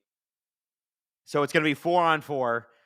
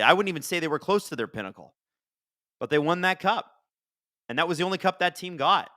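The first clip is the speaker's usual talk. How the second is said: normal talking voice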